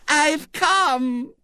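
put-on very high, slow little-girl voice; small triumphant voice. A narrator's reading. Little girl who has caught up with William, small triumphant voice. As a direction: pipe up in triumph as a small child. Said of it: "small, triumphant voice".

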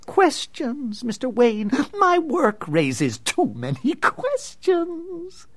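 high, slow obsessed mutter; brooding villain. Acted. Villain brooding over photos.

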